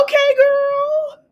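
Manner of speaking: put-on high, slow, drawn-out sassy okay girl; shady okay girl. Pretend shady reply to Nell.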